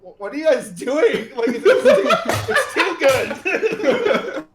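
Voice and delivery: gush, cracking up; laughing giddy fan squeal; laughing overexcited. Retold or imitated. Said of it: "Avatar is like".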